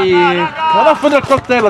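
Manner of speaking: normal talking voice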